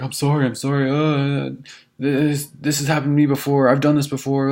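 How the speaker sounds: put-on slurring drunk guy. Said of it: "his words were a little slurred".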